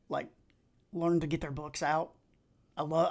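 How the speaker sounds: normal talking voice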